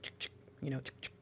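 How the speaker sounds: camera click sound. Retold or imitated. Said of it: "hold their fingers up ... be like".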